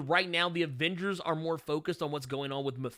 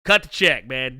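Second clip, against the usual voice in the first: louder, punching CUT; loud demand; 2 semitones lower, 8 dB louder, slower than usual. Shown as a demonstration. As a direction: hammer the word cut. Repeats demand to Marvel.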